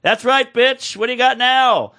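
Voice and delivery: high-pitched mock yell; retold taunting yell. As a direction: imitate a cabbie yelling a taunt out the window. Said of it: "my guy yells".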